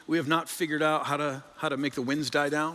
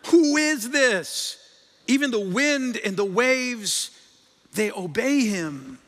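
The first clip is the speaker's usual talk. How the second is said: slow, louder, awestruck and shaken; frightened disciples gasping at what they just saw; terrified awe; 6 semitones higher, 5 dB louder, much slower than usual. A narrator's reading. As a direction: let the fear and wonder crawl out slowly. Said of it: "they were terrified".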